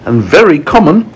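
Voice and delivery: high-pitched, snooty storybook lady; high, scandalised ladybird voice; disdainful ladybird. Acted. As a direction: gossip in a high voice about lesser relatives. Ladybird disparages her relatives.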